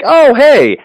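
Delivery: high, loud burst of sudden interest; excited reaction. Retold or imitated. Game master recalling a suggested superhero theme, excited reaction. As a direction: pipe up as an idea lands. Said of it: "and I'm like".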